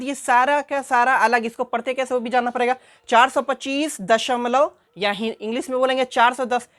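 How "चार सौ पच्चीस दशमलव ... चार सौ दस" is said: usual speaking voice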